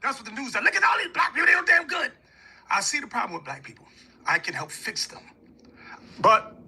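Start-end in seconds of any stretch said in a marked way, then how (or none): 0.6-2.1 mocking shout, much higher. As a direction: shout it like an outraged news anchor. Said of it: "Don't come to me and say".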